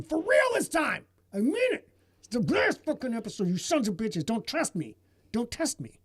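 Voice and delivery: high-pitched exasperated insistence, easing in volume; mock angry tirade. Host warning fans it is truly the last episode, mock angry. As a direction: scold the fans in hammed-up outrage.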